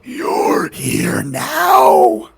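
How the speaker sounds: drawn-out creature cry, pitch rising at the end. Acted. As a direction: stretch the words out like an eerie creature. Game master voices creatures.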